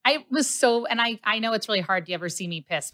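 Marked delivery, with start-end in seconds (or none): none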